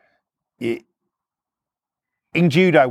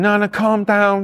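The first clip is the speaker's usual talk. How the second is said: higher-pitched imitation of placating; retold pleading to calm down; 6 semitones higher, 3 dB louder than usual. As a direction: act out trying to calm him. Woman placating man, retold.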